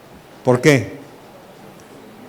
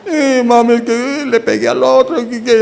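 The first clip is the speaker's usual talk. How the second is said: put-on higher, small child confessing; a little girl owning up to mommy, higher; child's voice; 8 semitones higher than usual. Little Sofi confessing to her mom, in a higher child voice.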